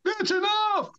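high, urgent mock yell; retold shout. Crew to men still throwing bales, shouting. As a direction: shout it up to make them stop.

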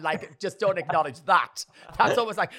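ordinary talk

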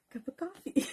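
high giggly laugh. Real, not acted. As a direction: laugh through the words. Laughs at own offer.

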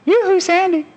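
high falsetto, coaxing sing-song; tempting Satan. Acted. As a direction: lure an old friend back with a teasing call. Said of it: "He says, he says".

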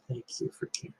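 soft unvoiced thanks; tearful whisper. Son thanks the parent with a tear, whispered. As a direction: breathe it out through tears. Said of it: "looked at me with a tear in his eye".

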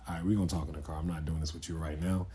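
calm. Retold or imitated. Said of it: "talk very calmly".

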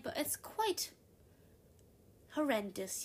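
quite pitched high in a put-on British-style accent; lightly done posh British accent. Shown as a demonstration. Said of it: "I can talk like this all the time".

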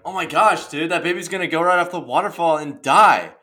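hammed-up freakout about the baby; acting out his own alarm, loud and high; mock panic. Imitating himself panicking.

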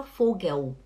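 Vogel ending in U, "fo-gueu"; wrong final L. Shown as a demonstration. Teacher repeating the error to avoid, L as U. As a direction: say Vogel with no L, gliding to U. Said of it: "não fala Vogel".

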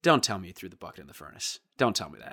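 low, quick, deadpan groan of disbelief; weary front desk guy. Retold or imitated. Front desk guy to the kid who threw the bucket, low deadpan dismay. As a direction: sigh it out like he's seen it all. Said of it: "the guy just turns to him and goes".

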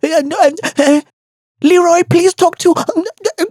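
high, halting mock stammer; Musa. Musa can't speak properly while being beaten, mocking stammer. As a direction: imitate a man tripping over half-formed syllables. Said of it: "He can't structure a sentence".

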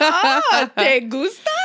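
high, loud teasing question; teasing delight. Retold or imitated. Teasing the assistant about his crush, high and loud. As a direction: tease him playfully in Spanish. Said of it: "And I'm like".

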